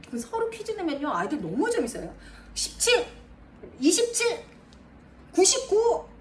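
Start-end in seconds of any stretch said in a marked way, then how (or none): 2.5-6.1 put-on high, eager child calling out numbers; imitating a child. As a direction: call the numbers like an excited kid. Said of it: "아이가 이렇게 쓴다는 거죠". (신나서 숫자를 외치는 아이 흉내)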